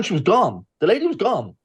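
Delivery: usual speaking voice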